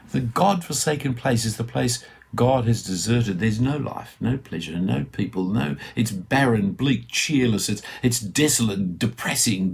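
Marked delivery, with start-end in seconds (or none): none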